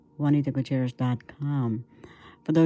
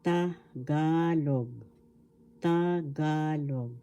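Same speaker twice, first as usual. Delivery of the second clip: "Tagalog" very slowly, ta-GAAA-log; stress on second syllable; much slower than usual. Shown as a demonstration. Teacher showing where the accent is, slow "Tagalog" stressed on GA. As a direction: split "Tagalog" into syllables and stretch GA. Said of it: "The accent is in the second syllable".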